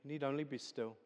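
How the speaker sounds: soft, calm reassurance; gentle and calm. Acted. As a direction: speak as God soothing a frightened child. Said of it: "gently and calmly say".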